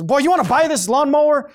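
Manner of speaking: loud, high mock-frustrated shout; exasperated offer. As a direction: call it out as a fed-up seller. Acts out exasperated boy selling mower.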